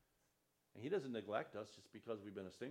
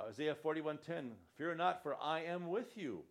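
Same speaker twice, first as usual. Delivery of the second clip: louder, slow and declaiming, proclaiming each promise; preaching scripture; 5 semitones higher, 8 dB louder than usual. Acted. Pastor proclaiming Isaiah to his congregation, loud and slow. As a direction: thunder out God's promises to the congregation. Reads scripture at full force.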